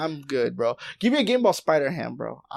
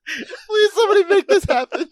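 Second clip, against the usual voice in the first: laughing plea; 6 dB louder than usual. Real, not acted. Pleading through laughter.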